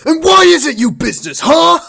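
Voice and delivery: full high-pitched furious shout; as angry as possible. Shown as a demonstration. Co-host asks for Ricky's line at full anger, furious shout. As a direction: yell it in over-the-top fury. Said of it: "very as angrily as you can".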